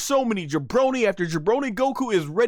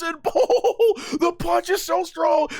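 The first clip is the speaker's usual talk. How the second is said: high, laughing exclamation; gasp-laugh at the punch; 9 semitones higher, slower than usual. Real, not acted. Fan laughing at how strong the punch is.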